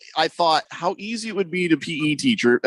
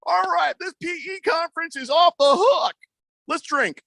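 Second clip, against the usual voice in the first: high, driving gym-teacher pep talk; rally the room like a hyped-up coach; P.E. conference host; 11 semitones higher than usual. Acted. Coach opening a conference of P.E. teachers, hyped-up announcing. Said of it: "That's how I envision that".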